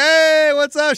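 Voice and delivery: mock cheerful hello; waving hello. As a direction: call down to the cousin. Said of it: "I'm like".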